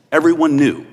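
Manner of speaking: ordinary delivery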